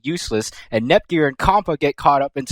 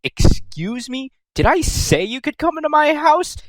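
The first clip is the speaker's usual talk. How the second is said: pitched-up prissy outrage; indignant Noir; 10 semitones higher than usual. Retold or imitated. Noir telling Neptune off at her door, high indignant voice. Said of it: "Noir is like".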